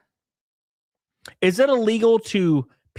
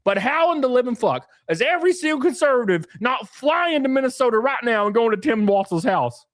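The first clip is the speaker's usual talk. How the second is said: ordinary talk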